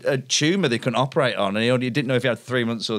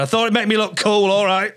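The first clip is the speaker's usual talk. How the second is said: high, raised-voice retold admission; defensive outburst; 7 semitones higher, 4 dB louder than usual. Comic made to repeat himself to friend, defensive outburst. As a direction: blurt it out defensively, higher. Repeats after being asked what.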